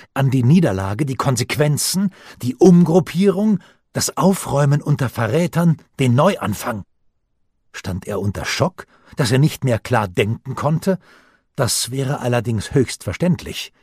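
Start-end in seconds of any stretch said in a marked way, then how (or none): none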